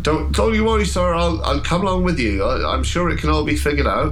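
high-pitched, fussing servile voice; placating William. Acted. William offers to come along with sir, placating. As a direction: placate an upset gentleman in a high voice. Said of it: "sort of being like".

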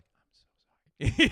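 exaggerated voiceless whisper; mock whispered apology. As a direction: mouth a secret apology in a drawn-out whisper. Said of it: "I gave him the eyes like".

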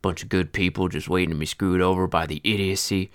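put-on deep voice with an old-timey Western twang; an old Western storyteller, twang in every word. Old feed seller tells his bitter tale, in a deep old-timey West twang. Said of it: "my deep voice and old-timey West twang".

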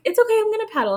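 high, chirpy mock of an upbeat husband; hammed-up cheerful husband voice; cheery husband. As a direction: play him all sunny and eager. Husband quoted on kayak trip.

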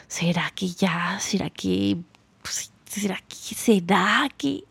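fading into a whisper; hushed, slow self-doubt; anxious whisper. Retold or imitated. Doubting inner voice in breakup. (susurro lento y dudoso)

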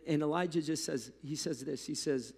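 ordinary talk

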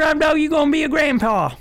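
shouting congratulations happily; excited shout. Real, not acted.

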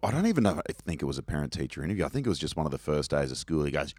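ordinary talk

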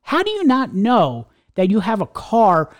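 slow, emphatic disbelief; slowly hammering an exasperated question; real incredulity. Real, not acted. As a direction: drag out each word in exasperated disbelief. Incredulous rant at the truck driver.

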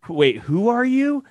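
high, slow mock confusion; puzzled prospect. Prospect asking who this broker is, mock puzzled. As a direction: play a baffled stranger, lightly.

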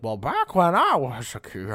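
loud, slow, drawn-out caricature; mock old-timer. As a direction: exaggerate a stuck-in-the-past grumble. Caricature of someone stuck in past.

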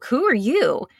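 acted-out startled question; startled retort. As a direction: fire back as the baffled listener. Acted reply to loud voice.

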